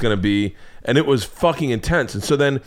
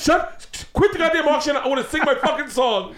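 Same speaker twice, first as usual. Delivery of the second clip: high, hushed irritated whine; high muted angry grumble; annoyed hiss; 13 semitones higher than usual. Retold or imitated. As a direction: hiss at them mid-concert. Annoyed sister hissing.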